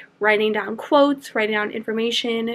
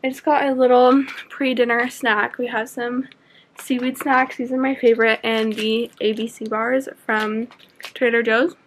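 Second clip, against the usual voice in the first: ordinary delivery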